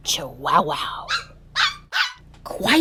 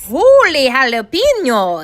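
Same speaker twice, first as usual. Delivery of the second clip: high, loud burst of amazement; excited exclamation; 6 semitones higher, 7 dB louder than usual. A narrator's reading. Kitten tumbles into stuffed animals, exclaiming. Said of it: "exclaimed Skippy John Jones".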